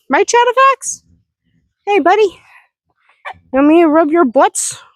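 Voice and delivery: pet talk. Real, not acted. Talking to the horse.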